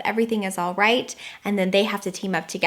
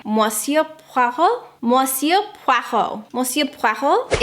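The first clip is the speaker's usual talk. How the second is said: French accent; 3 semitones higher, slower than usual. Shown as a demonstration. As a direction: try the name "Monsieur Poirot" in careful French. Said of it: "it's French ... but I will try".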